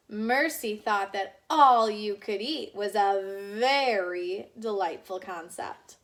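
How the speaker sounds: ordinary delivery, nothing marked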